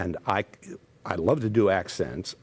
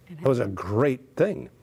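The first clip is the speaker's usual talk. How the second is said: ordinary talk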